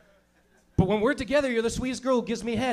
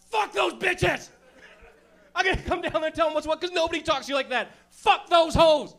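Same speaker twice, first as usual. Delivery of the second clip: put-on fierce girlfriend defender; 10 semitones higher, 5 dB louder than usual. Best friend defending Ashley, high furious squeal. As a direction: scream it like an outraged best friend. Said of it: "what's your friend gonna say".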